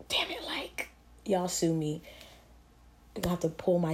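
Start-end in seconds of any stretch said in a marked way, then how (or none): 0.1-0.9 whispered curse under the breath. Real, not acted. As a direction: hiss it to herself.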